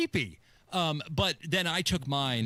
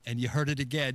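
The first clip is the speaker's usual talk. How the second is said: ordinary talk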